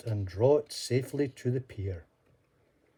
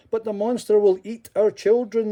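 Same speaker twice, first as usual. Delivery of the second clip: fearful crowd; 10 semitones higher, 7 dB louder than usual. Acted. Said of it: "they told him".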